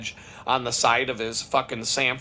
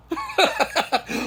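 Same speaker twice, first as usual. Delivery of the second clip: high-pitched burst of laughter. Real, not acted. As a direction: laugh at your own paranoid thought. Laughs at his own suspicion.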